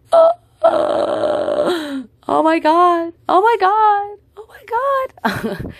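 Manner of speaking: high, rising oh my God, fading slow; losing it on the dock; panicked disbelief. Retold or imitated. Narrator realizing she missed the ship, high panicked oh my God.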